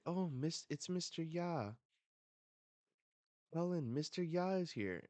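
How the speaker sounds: high, loud acted children's exclamations; excited kids. Hostages see Mister Ya arrive, excitedly. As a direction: pipe up as kids spotting a rescuer.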